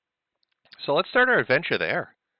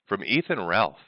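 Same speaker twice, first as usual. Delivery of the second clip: ordinary talk, nothing marked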